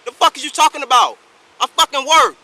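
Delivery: yelling furiously at the cop; angry shouting. Real, not acted. Angry man shouting at police officer.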